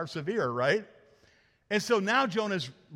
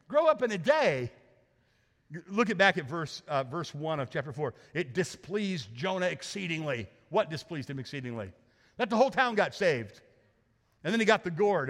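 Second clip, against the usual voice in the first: ordinary talk, nothing marked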